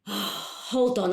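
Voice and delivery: ordinary talk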